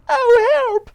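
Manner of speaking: wife shrieking for help; scream. Acted. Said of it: "screamed Miss Twit".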